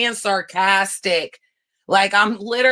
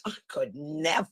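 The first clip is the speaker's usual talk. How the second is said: ordinary delivery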